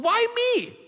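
a high, strained why me; voicing pain's own question; high pained cry. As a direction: lift it high like a hurt plea. Pain asking why me.

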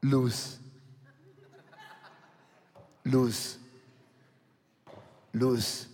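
fading repeated Luz; dropping whisper-like name. Shown as a demonstration. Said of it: "Früher hieß".

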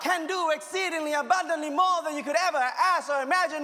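high, loud preacher's cry building through the lines; rising, loud sermon delivery; full-force preaching. Acted. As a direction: preach it out loud to the whole room.

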